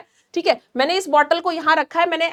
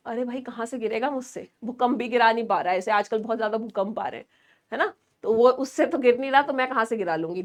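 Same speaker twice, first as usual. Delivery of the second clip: much softer, quick throwaway joke; joking aside; 5 semitones lower, 7 dB quieter than usual. Real, not acted. Teacher jokes to students that even earthquakes fail, softly amused. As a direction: drop the voice for a wry aside.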